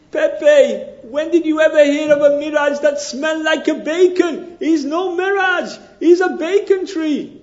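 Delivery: hammed-up Mexican wanderer sniffing bacon; comic Mexican accent. Joke voicing Mexican friend.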